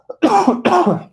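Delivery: harsh coughing fit. Real, not acted. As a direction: cough hard and loud. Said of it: "sorry I'm coughing so much".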